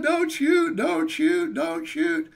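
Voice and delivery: high loud begging that sinks; plead, then lose steam; frightened begging at gunpoint. Retold or imitated. Begging man with gun.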